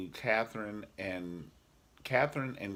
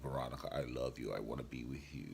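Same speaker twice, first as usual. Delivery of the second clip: David's voice; 8 semitones lower, 9 dB quieter, faster than usual. Retold or imitated. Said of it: "his David voice".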